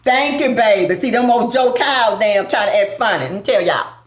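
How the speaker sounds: normal talking voice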